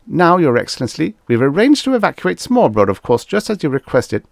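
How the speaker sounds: high, quick, eager deference; placating. A narrator's reading. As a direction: placate a superior with hurried assurances. Diplomat eagerly placating the alien leader.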